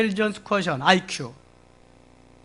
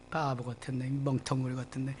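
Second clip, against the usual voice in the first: muttered scolding; 4 semitones lower, 12 dB quieter than usual. Acted. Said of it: "책망을 하고 있습니다".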